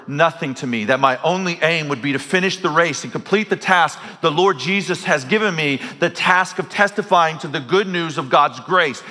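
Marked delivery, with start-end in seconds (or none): none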